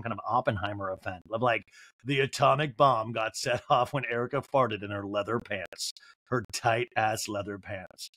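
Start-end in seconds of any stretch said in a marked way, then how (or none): none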